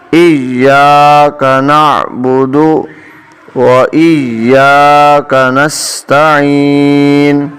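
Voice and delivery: very slow melodic chant; Quranic recitation. Shown as a demonstration. Teacher recites the complete verse, slow chanting. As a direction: recite the full verse slowly. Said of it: "اب اس پوری آیت کو پڑھیں گے".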